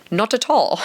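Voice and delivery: light mock British accent on "Not at all"; a playful try at his accent; imitating his accent. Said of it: "That's the thing that he says".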